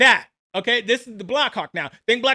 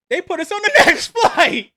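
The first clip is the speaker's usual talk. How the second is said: laughing; 6 semitones higher, 6 dB louder than usual. Real, not acted.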